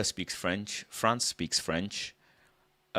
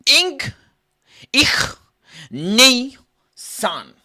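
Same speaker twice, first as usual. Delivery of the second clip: high loud squeaky count; mock Japanese counting; 14 semitones higher, 13 dB louder, much slower than usual. Comic fakes Japanese counting, high loud yelps. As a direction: yelp the numbers high then drop.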